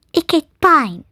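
mildly offended little creature; angry squirrel. A narrator's reading. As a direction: state your name crossly. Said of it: "zei de eekhoorn boos".

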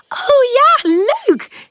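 very high, tiny delighted voices; giggly little flowers. A narrator's reading. Flowers welcoming a game with Pip, giggly delight. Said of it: "giechelen de bloempjes".

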